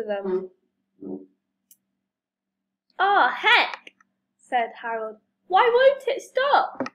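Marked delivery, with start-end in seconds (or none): 3.0-3.9 loud, higher outburst; raised cry of alarm; dismayed exclamation. Acted. Plane runs on towards farm. 5.5-6.9 loud, very high question; high storybook wail of dismay; exasperated alarm. Acted. Runaway plane will not stop.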